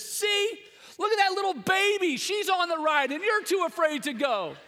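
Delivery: high, loud mocking yell of a kid brother; taunting yell. Younger brother taunting his scared older brother, high loud jeer. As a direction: jeer at him like a bratty little brother. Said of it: "he yells at his older brother".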